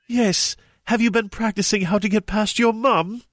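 a lightly voiced clang; mild mock brassy Hermione. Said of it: "she clanged".

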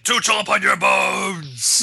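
slow shouted monster threat. Acted. Reader voicing the monster threatening Zach, slow shout. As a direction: roar it like a hungry beast.

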